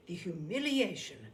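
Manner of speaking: plain reading voice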